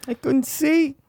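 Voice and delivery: high, strained Wahlberg cry; mock anguished Wahlberg line; Wahlberg acting. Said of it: "acting chops for Marky Wahlberg".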